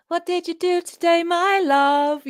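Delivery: imitating a high-pitched British accent. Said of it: "You say it almost very British".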